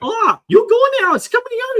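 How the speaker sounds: squeaky falsetto dub voice, loud; mock high voice. Mocking the Thai dub of Idris Elba, squeaky high. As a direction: pitch it way up like a silly dub. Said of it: "He's like".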